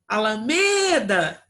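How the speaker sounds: "Alameda" called loud and slow, "me" held long; demonstrative name-call. Shown as a demonstration. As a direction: stretch the "me" in "Alameda" as if calling someone. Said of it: "Como se a gente estivesse chamando o nome de uma pessoa".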